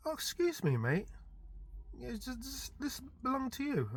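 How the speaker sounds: mock-polite bloke asking about lost items; polite stranger voice. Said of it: "were like".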